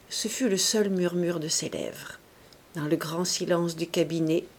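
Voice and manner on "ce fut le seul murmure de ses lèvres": ordinary delivery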